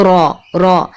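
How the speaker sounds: را with a light tapped r; را with one flick of the tongue. Shown as a demonstration. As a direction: give را a quick tongue tap. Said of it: "ٹکرانے والی کیفیت".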